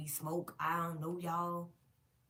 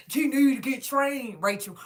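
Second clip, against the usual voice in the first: forceful retold scolding, pitched up; scolding mama; 4 semitones higher, 9 dB louder than usual. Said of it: "the mama kept saying".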